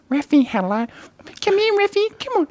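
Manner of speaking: very high cooing to a dog; dog-talk voice. Shown as a demonstration. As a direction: call the dog in a squeaky pet voice. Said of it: "I have that dog voice too".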